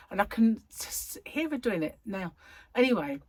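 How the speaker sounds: normal talking voice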